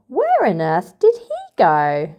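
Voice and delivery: plain reading voice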